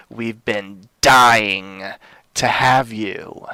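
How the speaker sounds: dying drawn out slow and loud; slow menacing drawl. Acted. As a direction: stretch every word, loud and ominous. Horror host drawn-out menace.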